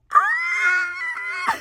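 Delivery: sudden high delighted shriek; excited squeal. Real, not acted. Reader reaching the kiss, then a delighted shriek. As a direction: shriek with sheer delight at the page.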